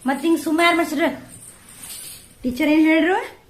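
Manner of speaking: loud, high questioning. Real, not acted. Mother questioning child about school.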